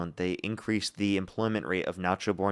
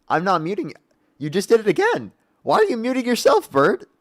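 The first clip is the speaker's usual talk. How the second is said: exasperated; 10 semitones higher, 6 dB louder than usual. Real, not acted. Streamer exasperated at muting.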